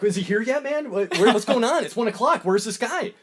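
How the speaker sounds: high, loud, rising panic questions; building agitation; mock frantic Tom Cruise; freaking out.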